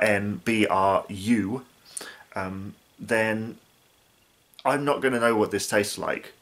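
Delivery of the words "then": normal talking voice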